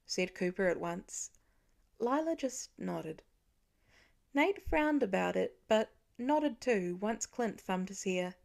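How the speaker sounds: usual speaking voice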